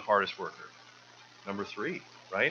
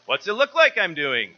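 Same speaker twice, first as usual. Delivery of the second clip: higher, louder sarcastic snap back; sarcastic reply; 8 semitones higher, 9 dB louder than usual. Acted. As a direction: fire back a mocking retort at the questioner. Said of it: "he replied sarcastically".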